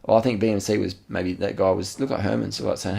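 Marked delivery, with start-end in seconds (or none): none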